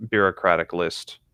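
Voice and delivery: ordinary delivery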